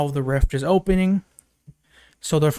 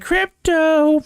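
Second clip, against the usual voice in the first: high, drawn-out dog call; mock calling out; 13 semitones higher, 3 dB louder than usual. Supergirl calling for her dog Krypto, mock call. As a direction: imitate calling a pet, voice pitched way up.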